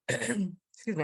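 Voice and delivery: losing her voice, hoarse. Real, not acted. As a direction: speak through a hoarse, failing voice. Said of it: "I'm not sure why I'm losing my voice".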